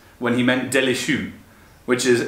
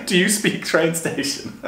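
higher, slow repeat through laughter; laughing; 5 semitones higher, slower than usual. Real, not acted. Repeating the language blunder he loves, laughing. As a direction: savour the silly question, cracking up.

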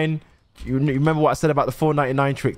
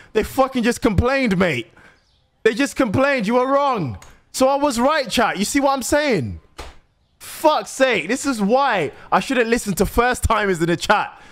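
high-pitched, indignant telling-off; exasperated rant; 6 semitones higher than usual. Real, not acted. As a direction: blame the chat in raised pitch. Blaming chat for bad pricing advice.

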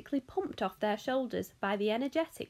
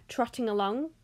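usual speaking voice

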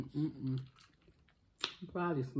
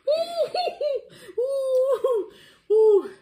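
high squealing laughter; laughing hard; 7 dB louder, much slower than usual. Real, not acted.

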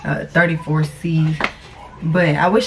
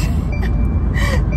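high laugh; 5 dB louder than usual. Real, not acted. Laughing about the beeps.

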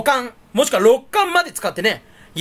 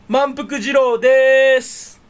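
slow, higher, drawn-out announcer greeting; comic persona greeting; 4 semitones higher, 5 dB louder, much slower than usual. Acted. Comedian introducing himself as a character, hammed-up. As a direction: stretch it like a stage name intro. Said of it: "どうも、".

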